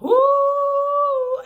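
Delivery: long high ooh; appreciative ooh. Real, not acted. Fan raving about the Sir song, long high ooh. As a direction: let out a high, held "ooh" over the music.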